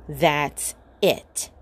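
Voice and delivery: fed-up friend, lightly voiced; Jake's voice. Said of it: "said Jake".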